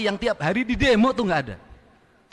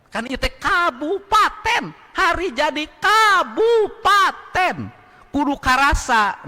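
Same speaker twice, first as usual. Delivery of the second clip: high, loud and slow; rally speech; 9 semitones higher, 3 dB louder, slower than usual. Real, not acted. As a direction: hammer the point home. Rally emphasis.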